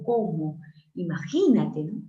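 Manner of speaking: ordinary delivery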